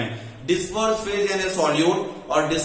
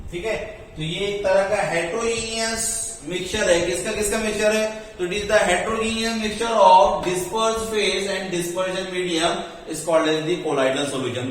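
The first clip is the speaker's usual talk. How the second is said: usual speaking voice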